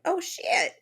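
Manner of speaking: high, startled oh-shit; startled yelp. Retold or imitated. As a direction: jump at a sudden scare. Startled victim at lake.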